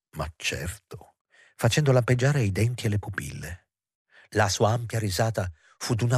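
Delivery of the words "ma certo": low, soft, drawn-out sneer. A narrator's reading. Juliette confirms she is blackmailing him, sneering. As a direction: savour it slowly, sneering under the voice. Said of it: "Lei ghignò".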